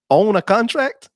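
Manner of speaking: high, incredulous imitation of a Southern "contract"; mock the Southern accent, rising into a question. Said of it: "What, what happened to on?".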